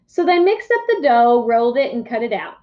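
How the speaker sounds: usual speaking voice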